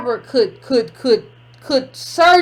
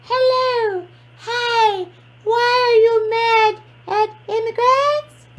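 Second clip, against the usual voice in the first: high, slow, mocking little-kid voice; mock five-year-old voice; 8 semitones higher, slower than usual. Host scolding a caller who disguised their voice, mock child voice. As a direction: imitate the caller as a drawn-out small child. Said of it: "don't call here like you five".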